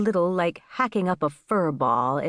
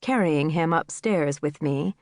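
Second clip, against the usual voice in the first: usual speaking voice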